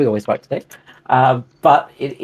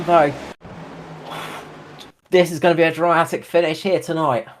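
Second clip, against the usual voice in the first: motorsport commentator; 6 semitones higher than usual. Acted. Race commentary after crash.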